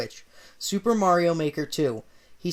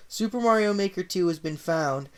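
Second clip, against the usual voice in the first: ordinary delivery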